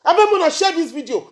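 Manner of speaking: loud, furious outburst; angry shout. Real, not acted. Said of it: "I'm angry now".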